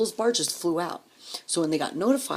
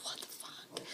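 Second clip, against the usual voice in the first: barely audible, mouthed swearing; whispered disbelief; 17 dB quieter, slower than usual. Retold or imitated. Woman hearing the husband is off volunteering, whispered disbelief. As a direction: breathe it out as a silent aside.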